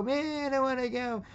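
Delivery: high, mock childish whine; whining boy. Boy refuses to leave with his parents, imitated whining. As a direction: imitate a kid complaining in a high voice. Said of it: "he's whining".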